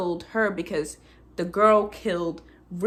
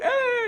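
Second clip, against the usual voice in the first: high-pitched imitated sob; hammed-up tearful "ah"; mock crying wail. Viewer reacting to the cave scene, mock wailing. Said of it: "I'm crying, I'm emotional".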